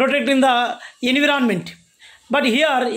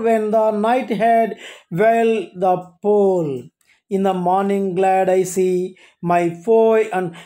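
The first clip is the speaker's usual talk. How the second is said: poem recital; 3 semitones lower than usual. Acted. Teacher reciting the poem to students, measured. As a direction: recite it line by line to the class.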